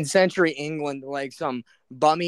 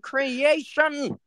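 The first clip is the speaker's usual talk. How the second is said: slow, drawn-out baffled voice; a villager gawking at something strange; mock awed villager; 7 semitones higher, much slower than usual. Friend voicing past people seeing a tank, slow baffled. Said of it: "They'd be like".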